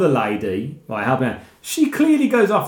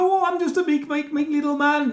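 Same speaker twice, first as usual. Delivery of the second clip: meek little man; 8 semitones higher than usual. Retold or imitated. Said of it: "he goes".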